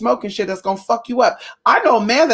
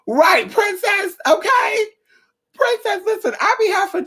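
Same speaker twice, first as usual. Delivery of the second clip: high mock scolding; 12 semitones higher than usual. Calling herself in, mock scold.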